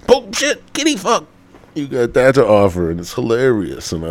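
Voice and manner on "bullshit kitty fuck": rising into a high blurted tic; tic impression. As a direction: let the curse slip out higher like a tic. Mimicking a Tourette's tic.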